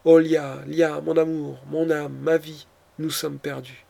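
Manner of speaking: ordinary delivery, nothing marked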